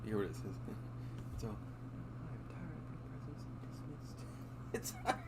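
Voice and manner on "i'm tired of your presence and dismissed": hushed, breathy whisper, much softer; whispered meme line. Pretend. Streamer reading a funny gif to viewers, whispering. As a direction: whisper the dismissal like a secret.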